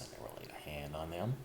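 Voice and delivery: ordinary delivery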